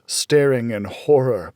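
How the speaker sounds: usual speaking voice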